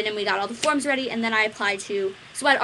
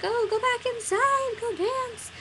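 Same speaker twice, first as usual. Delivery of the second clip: much higher, slow, sing-song; coaxing grandma; 13 semitones higher, slower than usual. Retold or imitated. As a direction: sweetly coax a child back.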